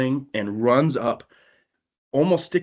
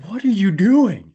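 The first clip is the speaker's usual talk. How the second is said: high, loud and slow, near-shrieking; panicked yell; 9 semitones higher, 7 dB louder than usual. Retold or imitated. To Case driving wildly, loud panicked high yell. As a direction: yell it while hanging on terrified. Friend screaming, speeding in dark.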